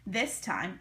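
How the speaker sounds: usual speaking voice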